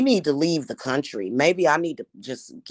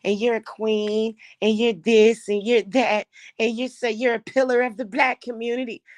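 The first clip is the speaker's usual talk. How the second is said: mocking fake praise; 6 semitones higher than usual. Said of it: "And then people will say".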